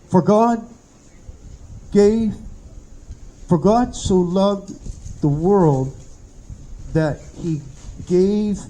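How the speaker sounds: slow reading with long pauses; halting reading. Retold or imitated. Dying man reading scripture to the preacher, halting and slow. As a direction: read it a few words at a time, as it sinks in. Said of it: "as he's reading, he says".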